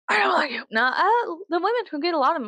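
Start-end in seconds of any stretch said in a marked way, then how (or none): 0.1-0.6 higher, full-voiced dislike; high declaration. Shown as a demonstration. Telling rich people she dislikes them, high and voiced. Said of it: "contrasted takes".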